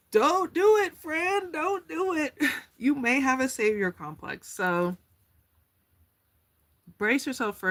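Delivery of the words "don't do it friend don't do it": high-pitched mock plea, louder; a playful high plea to a friend; high pleading. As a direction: squeak a pleading don't do it, friend. Pleading with viewer.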